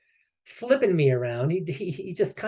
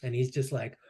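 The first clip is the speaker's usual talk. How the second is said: ordinary delivery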